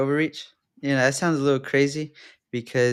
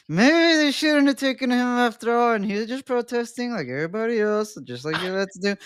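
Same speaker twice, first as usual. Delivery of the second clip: clenched-teeth voice, pitched up; talking through teeth; 8 semitones higher than usual. Real, not acted. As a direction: speak with teeth locked together. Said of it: "'cause I'm talking through my teeth".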